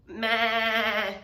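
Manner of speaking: lightly voiced goat meh; a mild goat bleat. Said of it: "said Goat".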